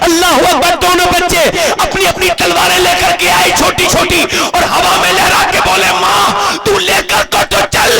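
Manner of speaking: climactic high sermon cry; fervent, straining proclamation; full-force preaching. Real, not acted. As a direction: build to a rousing shout for the crowd.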